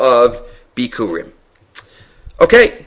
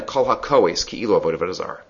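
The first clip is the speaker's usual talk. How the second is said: low hushed quote; 9 semitones lower, 10 dB quieter, faster than usual. Retold or imitated. Rambam's quote, dropping low.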